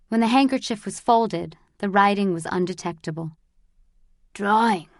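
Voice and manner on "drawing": ordinary delivery, nothing marked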